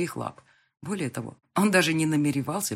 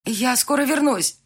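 louder, higher, slowly called; calling out; 8 semitones higher, 7 dB louder, slower than usual. Acted. Bride calling to fiancé outside the door, loud. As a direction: call through the door.

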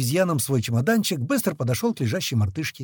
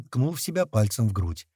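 plain reading voice

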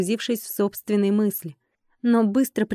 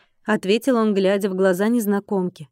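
ordinary delivery

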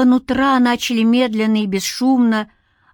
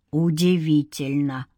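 deathly voice; 5 semitones lower than usual. A narrator's reading. An undead spirit addressing a captive man, deathly voice. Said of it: "повторил мертвящий голос".

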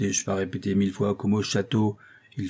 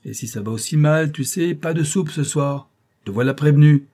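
raised, higher-pitched telling-off; exasperated teacher lecturing loudly; stern scolding; 5 semitones higher, 5 dB louder than usual. A narrator's reading. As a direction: harangue the pupil with threats and orders. Teacher scolding beaten pupil.

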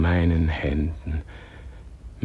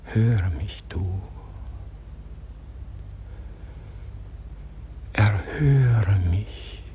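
soft, slow, breathless imploring whisper; whispered plea; 3 semitones higher, 7 dB quieter than usual. Acted. Praying man begs God to hear him, in a fading whisper. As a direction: let the last plea dissolve into breath.

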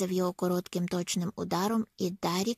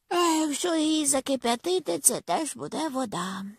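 high yawn stretched through the words; sleepy, yawning question sliding down from high pitch; 7 semitones higher than usual. A narrator's reading. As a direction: speak right through a big yawn. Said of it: "позіхнув Харрісон".

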